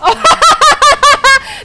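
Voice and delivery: rapid high-pitched laughter; pealing staccato laugh; loud high cackle. Real, not acted. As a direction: burst out in a long cackle. Reacts to eight-minute surrender vote.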